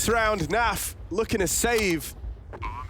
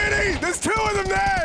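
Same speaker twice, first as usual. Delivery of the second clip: excited yell. Real, not acted. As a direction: yell it at the screen. Caster yelling at the play.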